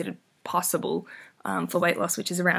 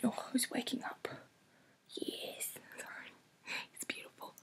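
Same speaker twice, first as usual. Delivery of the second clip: very soft whisper to the cat; barely-there tender whisper; 4 semitones higher, 16 dB quieter than usual. Real, not acted.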